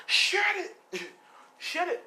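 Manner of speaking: very high-pitched mock yell; acted testy retort; irritated snap. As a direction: cut a friend off, voice way up. Bak snaps back at his friend.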